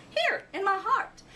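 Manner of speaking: happy little owl. A narrator's reading.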